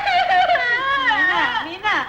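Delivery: long, high wail of the name; wailing cry. Acted. Parent crying out to weeping daughter, wailing.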